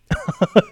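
extremely high squeak of a laugh; squeaky laugh. Real, not acted. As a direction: let out a squeaky giggle. Laughing at curt order. (尖细的一声笑)

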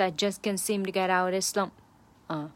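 ordinary talk, nothing marked

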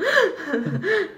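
rising laugh; high burst of laughter. Real, not acted. Laughing after ironic echo.

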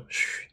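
soft, drawn-out "shh"; hushing shush. Retold or imitated. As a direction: shush a child gently to keep quiet. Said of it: "让他不要那个大声吆喝". (轻轻地“嘘”一声)

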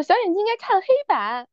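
high-pitched mock classroom recitation; girl answering teacher. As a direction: chirp the answer like the model pupil. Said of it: "有个姑娘站起来".

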